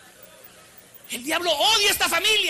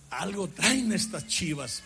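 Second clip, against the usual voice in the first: low, slow knowing mutter; a pastor's dry low aside; wry muttered aside; 8 semitones lower, 6 dB quieter, slower than usual. Retold or imitated. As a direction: say it under your breath, suspicious.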